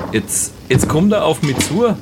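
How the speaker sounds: von besorgt bis panisch, immer höher; steigende Panik. Acted. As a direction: zunehmend verängstigt, am Ende hoch und langsam flehend. Android kommt auf ihn zu. (gespielte Panik vor dem Androiden, immer höher)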